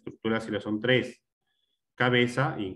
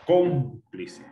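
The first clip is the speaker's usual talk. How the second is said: "cómplice" with a strong, drawn-out CÓM; stressed syllable; 3 semitones higher, 3 dB louder than usual. Shown as a demonstration. Teacher giving an example of tónicas, stressed syllable. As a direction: punch the CÓM of "cómplice", then release. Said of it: "las que llevan mayor fuerza de voz".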